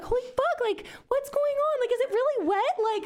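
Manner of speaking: very high-pitched, frantic bewilderment; mock panic. High student checking her pants for pee, in high-pitched mock panic. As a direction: squeal it like a retold freak-out.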